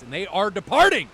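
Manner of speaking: loud, high call that the match is underway; hyped announcement. Real, not acted. Caster calling the departure to the stream audience, loud and hyped. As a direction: kick off the round with a big announcer call.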